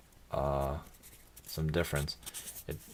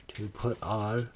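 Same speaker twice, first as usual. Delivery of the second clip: yawning; 4 semitones higher, slower than usual. Real, not acted. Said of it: "oh, I'm so sorry I'm yawning".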